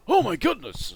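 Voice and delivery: loud, high startled cry; startled miller. Acted. Miller startled by the bird, loud high yelp. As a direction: jump in fright and shout it. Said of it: "he's like".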